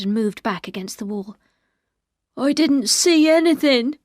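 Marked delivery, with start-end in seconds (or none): 2.4-4.0 higher, louder, slow and tearful; a scared man about to cry; frightened, near tears. Acted. Ben denying to Favell, frightened and near tears. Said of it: "He began to cry".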